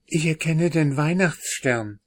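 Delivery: usual speaking voice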